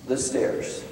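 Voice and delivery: whispered suspense; hushed. Retold or imitated. Preacher building suspense about footsteps, whispered. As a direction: drop to a breath on the stairs.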